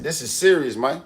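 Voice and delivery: ordinary delivery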